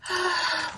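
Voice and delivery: exaggerated sigh. Mock self-pity sigh.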